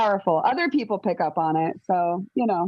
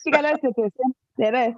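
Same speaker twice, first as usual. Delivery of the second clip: fake foreign language; 3 semitones higher, faster than usual. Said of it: "they created this fake language".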